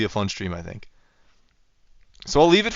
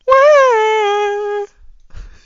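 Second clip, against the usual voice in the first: high-pitched squeal of laughter; 9 dB louder than usual. Real, not acted. Real burst of laughter.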